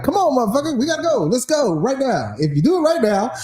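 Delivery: high, pushy sales pitch; car salesman voice. Retold or imitated. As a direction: sell it hard like a dealer.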